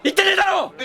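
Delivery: furious denial; angry retort. Acted. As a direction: yell back that he never said it. Losing player snaps at crewmate.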